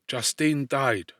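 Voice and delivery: usual speaking voice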